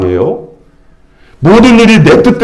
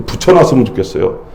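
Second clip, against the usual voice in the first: normal talking voice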